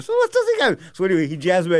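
ordinary talk

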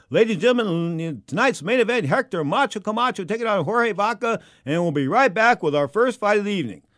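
ring announcer. Retold or imitated. Host introducing tonight's main event on TV, big announcer voice. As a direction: sell the main event to the TV crowd. Re-enacts his TV fight introduction.

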